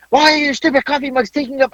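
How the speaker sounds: put-on high whiny teen daughter; mock complaint about the mugs, voice high; imitating annoyed daughter. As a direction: pitch it up like a fed-up kid. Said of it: "she's like".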